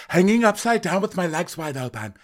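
high, lively Lisa voice; mock Lisa. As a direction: gossip like a delighted Lisa. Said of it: "And Lisa's like".

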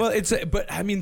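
ordinary talk

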